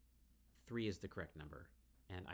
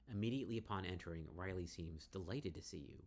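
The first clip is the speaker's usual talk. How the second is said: normal talking voice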